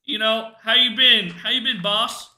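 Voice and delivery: high, loud, cheery bro voice; hyped bro greeting. Retold or imitated. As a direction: greet a buddy all pumped up. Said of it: "you could be like".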